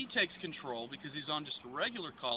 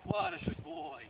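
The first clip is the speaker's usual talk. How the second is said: higher, sing-song praise for a dog; 13 semitones higher, slower than usual. Real, not acted. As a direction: reward the dog with a bright lift.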